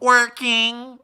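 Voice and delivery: high, loud robotic computer voice. Retold or imitated. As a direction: say it like a ship's computer. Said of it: "what's the Star Trek computer used to say".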